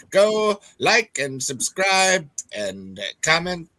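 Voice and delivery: put-on slow, grand old Hollywood drawl; transatlantic accent. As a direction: drawl it like a glamorous movie star. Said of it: "transatlantic accent".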